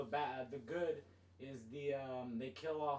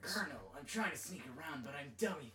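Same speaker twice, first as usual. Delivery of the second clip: put-on game soldier voice; Snake; faster than usual. Friend repeating the meme after another's impression, put-on voice. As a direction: do the game hero reporting in. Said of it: "I just did the best Snake impression".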